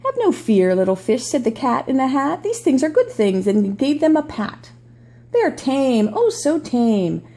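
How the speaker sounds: storybook read-aloud voice; reading to children. Acted. Reads picture book aloud.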